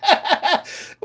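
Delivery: high, helpless laughing speech; giggling at your own silly voice. Real, not acted. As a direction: crack up while talking. Cracks up at own impression.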